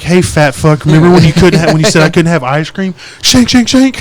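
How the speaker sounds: hammed-up vengeful kid, building to high stabbing noises; mock menace. Five-year-old threatening dad over ice cream, mock-menacing. Imagined daughter's knife revenge.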